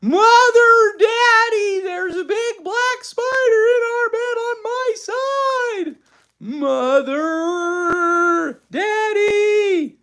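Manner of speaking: put-on high, loud child's scream. A narrator's reading. Frightened girl screaming to her parents about a spider. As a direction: scream for help, stretching out the names. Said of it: "went screaming into her mother and father's room".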